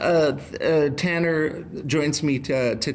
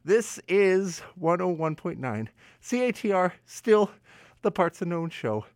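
ordinary talk

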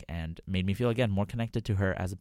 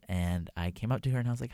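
normal talking voice

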